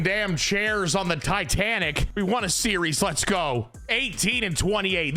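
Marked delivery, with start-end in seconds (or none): none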